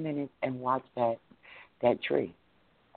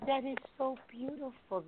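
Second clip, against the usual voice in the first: gushing delight; 14 semitones higher, 8 dB quieter than usual. Real, not acted. Repeats praise after laughing.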